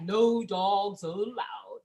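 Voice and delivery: slow, drawn-out cartoon voice; mock cartoon announcement; Snoopy cartoon sign. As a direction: read it like a cartoon sign, slowly. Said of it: "like the freaking Snoopy cartoon".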